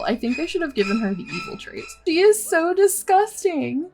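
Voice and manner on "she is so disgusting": high, drawn-out disgust. Real, not acted. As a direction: sound truly grossed out. Disgusted by Bianca flirting.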